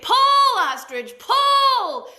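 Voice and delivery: very high, loud pull calls; cheering shout. A narrator's reading. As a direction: urge him on with shouts. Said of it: "the fish eagle was like".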